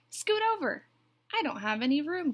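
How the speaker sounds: high, loud squawky grumble; complaining. A narrator's reading. Duck squashed back to back with Goose, complaining. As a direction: complain indignantly, voice jumping up. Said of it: "complained Duck".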